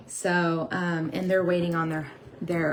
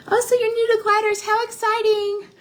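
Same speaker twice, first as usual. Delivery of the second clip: much higher, louder, fast gush; excited; 8 dB louder than usual. Real, not acted. Streamer thrilled a viewer is new to gliders, excited gush. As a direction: gush at the newcomer's news.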